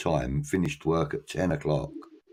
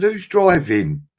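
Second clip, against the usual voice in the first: slow, higher, louder loaded question; pointed question; 12 semitones higher, 8 dB louder, slower than usual. Retold or imitated. Teller asking the drunk couple who will drive, slow pointed higher question. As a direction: ask it slowly, knowing the answer.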